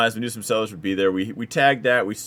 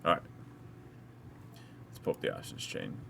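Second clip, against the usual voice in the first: whispering to himself; whispered; 7 semitones lower, 15 dB quieter than usual. Real, not acted. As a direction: whisper it under your breath. Muttering while at screen.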